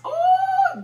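ooh flung up high, then Jesus; a spirit-filled cry soaring up; high ecstatic ooh. Real, not acted. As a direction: falsetto praise cry. Preacher overcome mid-sermon.

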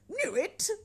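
high, incredulous exclamation; astonished echo. A narrator's reading. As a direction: echo it in delighted disbelief. Scrooge astonished at the question.

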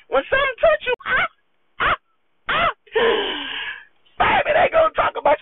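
a fast shouted warning, ramping up; rising shout. Real, not acted. Warning viewers about gossip, a fast rising shout. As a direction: climb high, then call it out fast.

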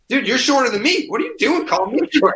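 high, incredulous retort; mock retort. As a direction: throw it back at him, voice way up. Retold reply to Twitter troll.

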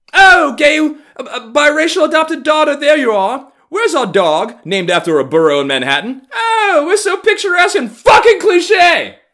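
over-the-top mock neighbor hunting for the child; shrieking mock theatrical call. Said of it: "so goddamn theatrical".

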